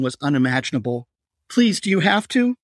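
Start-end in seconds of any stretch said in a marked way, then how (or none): 1.5-2.6 high, desperate plea; frightened begging. Acted. Patricia begging the knifeman to stop, desperate. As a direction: pitch it up, begging for mercy. Captive begging her attacker.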